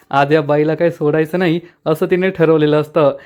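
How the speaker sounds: plain reading voice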